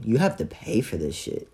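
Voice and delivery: usual speaking voice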